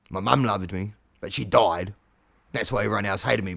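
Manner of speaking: ordinary talk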